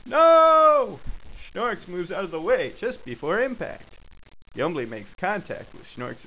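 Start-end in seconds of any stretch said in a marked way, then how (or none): 0.1-1.0 loud, high yell; dramatic cry. Acted. Schnorks cries out before the punch lands, yelling. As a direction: holler it in alarm.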